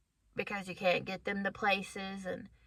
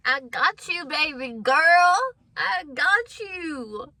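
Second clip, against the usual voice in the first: higher, louder, drawn-out promise; God's reassuring voice; 6 semitones higher, 8 dB louder, slower than usual. Acted. God answering her prayer for a car, drawn-out reassurance. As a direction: draw it out slowly, high and loud. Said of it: "and he was like".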